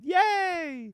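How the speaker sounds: high, drawn-out yay; mock fan cheer. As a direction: squeal it like a thrilled fan. Acting out delighted fans' reaction.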